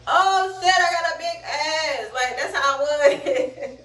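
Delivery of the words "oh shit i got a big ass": high-pitched mock astonishment; re-enacted groggy amazement; acted amazed discovery. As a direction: exclaim it high, as if just discovering it. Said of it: "I'm feeling".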